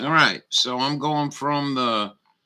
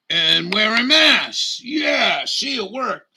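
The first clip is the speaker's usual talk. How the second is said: sarcastic high voice; 8 semitones higher, 5 dB louder than usual. Pretend.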